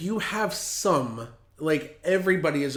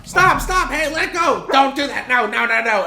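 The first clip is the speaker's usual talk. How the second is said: high panicked yelling; frantic high shouts at biting dogs; shouting at dogs; 9 semitones higher, 8 dB louder than usual. Retold or imitated. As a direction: yell at the dogs to let go.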